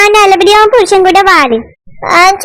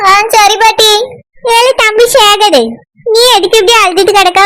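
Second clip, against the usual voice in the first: usual speaking voice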